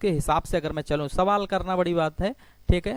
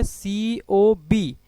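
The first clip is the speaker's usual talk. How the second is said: COB read very slowly, letter by letter; drawn-out angle name; 2 semitones higher, much slower than usual. Shown as a demonstration. Teacher reads COB slowly for the class, drawn out. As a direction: stretch out COB, each letter separately. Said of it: "इसको क्या बोलेंगे?".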